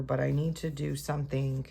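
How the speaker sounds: ordinary delivery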